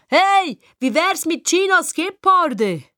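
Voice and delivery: high, drawn-out call; calling after someone. A narrator's reading. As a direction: call it teasingly after a departing friend. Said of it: "ruft noch etwas hinten an".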